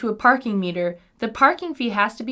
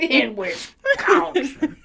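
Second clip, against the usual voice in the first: laughing slowly; 2 semitones higher, much slower than usual. Real, not acted. Slow laughing repeat.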